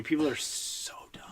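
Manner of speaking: whispered, soft aside; muttered whisper. Real, not acted. Griping about freeway drivers, in a whisper. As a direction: breathe it under your breath.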